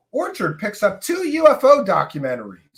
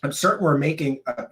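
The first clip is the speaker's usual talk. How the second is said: ordinary delivery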